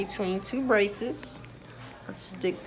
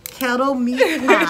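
laughing; 5 semitones higher, 11 dB louder than usual. Real, not acted. Teasing kettle pot joke, laughing.

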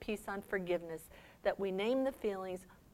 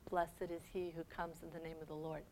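much softer, low and mournful; soft grieving blessing; 4 semitones lower, 11 dB quieter than usual. Acted. Jesus weeping over Jerusalem, soft and low. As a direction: let it fade in grief. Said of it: "starts to cry".

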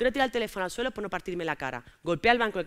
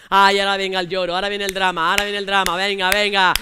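1 boyfriend mocking; 2 semitones lower, 8 dB louder than usual. Boyfriend mocking her tears.

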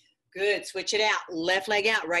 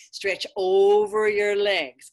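long loud coaching call; 7 dB louder, much slower than usual. Real, not acted. Yoga teacher driving a pose.

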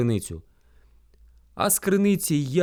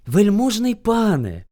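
slow, higher, deferential appeal; timid hare; 5 semitones higher, 4 dB louder, slower than usual. A narrator's reading. Hare cautions furious bear, meek. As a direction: address the master cautiously.

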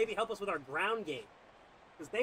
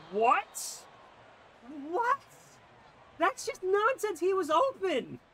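outraged rant; 11 semitones higher, 5 dB louder than usual. Real, not acted. Gamer raging at receiver who dropped the pass, high and loud. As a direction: let the frustration boil over at the dropped catch.

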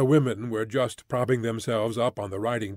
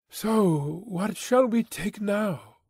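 lightly voiced, higher and drawn out; Greta's question; 6 semitones higher, slower than usual. A narrator's reading. Greta asks her mother what to take next, lightly voiced and higher. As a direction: ask it slowly, lifting the pitch.

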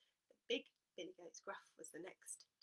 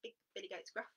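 usual speaking voice

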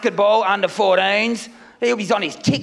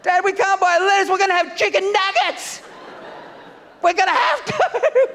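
put-on high whining child, much higher; whiny kid voice; 8 semitones higher than usual. Comic imitating his kids complaining about lettuce, high whine.

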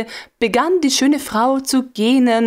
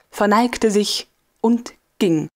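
ordinary delivery, nothing marked

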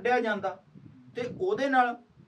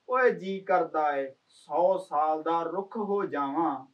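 slow, drawn-out line with ਓਏ leaned on; poem recitation; 4 semitones lower, slower than usual. Acted. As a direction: stretch the verse out slowly, opening on ਓਏ. Poem line, first take. (ਹੌਲੀ ਖਿੱਚ ਕੇ ਕਵਿਤਾ ਦੀ ਸਤਰ)

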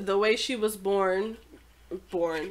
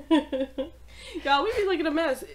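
giggling through the words; laughing; 7 semitones higher, slower than usual. Real, not acted. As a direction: laugh it out, pitch up. Laughing at their own looks.

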